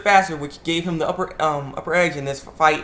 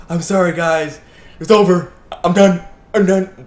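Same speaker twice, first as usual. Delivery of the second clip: dramatic despair; 3 semitones higher, 8 dB louder than usual. Retold or imitated. Rock Lee giving up, defeated.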